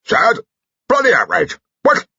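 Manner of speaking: put-on high, loud, blustering old general; spluttering outrage. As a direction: bark out your indignation.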